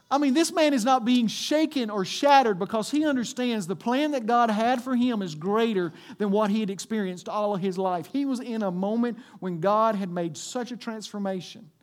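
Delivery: loud, high-pitched preacher's delivery; full-force preaching. Acted. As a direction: drive it home to the congregation, loud and high.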